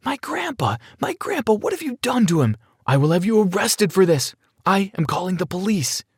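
very high, distressed cry without real volume; hammed-up high-pitched alarm, held back in volume; mock screaming woman. A narrator's reading. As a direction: wail it high, like an outraged granddaughter. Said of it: "began screaming".

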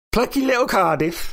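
put-on condescending Welsh accent; mock Welsh. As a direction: tease them in a Welsh accent. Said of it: "stay away from the accent".

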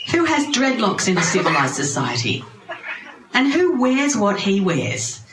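adamant objection. Acted. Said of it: "he was very adamant".

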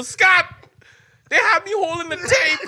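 high, loud holler across a field; imitated yell. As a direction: mimic someone yelling over a distance. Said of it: "this guy's yelling at me from across the field".

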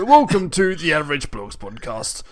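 lightly voiced dude intro; surfer dude. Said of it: "I can't do his voice".

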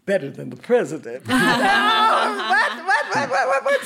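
high, loud, laughing whats; thrilled laughing repeats; laughing excitement. Retold or imitated. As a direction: burst out in gleeful disbelief. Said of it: "I said".

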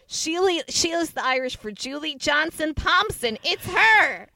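high, loud dramatic documentary reveal; mock Netflix reveal. Imagining a Netflix special, hammed-up big reveal. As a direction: announce the name like a true crime twist. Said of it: "it's like".

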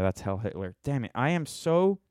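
ordinary talk

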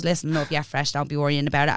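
a hint of a low, laid-back Dublin lad; lightly voiced lads. Voicing the lads reassuring her.

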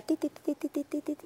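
high, quick mock bionic-woman beeps; bionic woman sound. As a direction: imitate the Bionic Woman running sound effect. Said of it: "me sentía yo como la mujer biónica". (efecto de la mujer biónica corriendo, en tono agudo)

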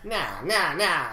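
put-on old-time radio interviewer patter; twenties gangster voice. Reading Relish Ram's email, twenties gangster voice. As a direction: talk like a vintage mobster. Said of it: "twenties interviewer voice".

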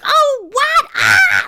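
high, loud outburst of disbelief; mock shocked crowd. Mimicking prudish moviegoers losing their minds, shocked shriek. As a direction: imitate an audience shrieking in scandal. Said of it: "everyone was just like".

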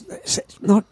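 normal talking voice

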